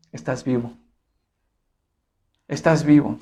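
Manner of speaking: soft, low, sober statement; hushed reminder. Real, not acted. Preacher answers one who saw no miracle, hushed and low. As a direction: drop low and quiet, let it sink in.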